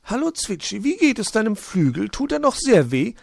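high magpie voice, full of pity; sympathetic magpie. Acted. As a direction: ask after the hurt wing kindly. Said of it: "fragte sie mitleidig".

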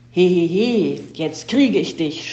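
loud, gloating mock laugh into a drawn-out threat; villain's shout. A narrator's reading. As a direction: shout it slowly like a storybook villain. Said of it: "schrie er und stürzte".

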